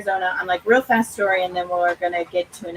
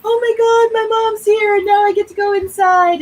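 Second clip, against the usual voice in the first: much higher, louder excited dog voice; happy dog voice; 12 semitones higher, 8 dB louder than usual. Pretend. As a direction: voice the thrilled malamute. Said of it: "super sad Tala to".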